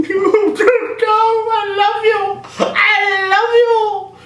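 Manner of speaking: high, slow, hammed-up sob; mock crying. Rey crying to the gladiator taken away, mock sobbing. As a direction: wail the farewell in fake tears. Rey cries after him.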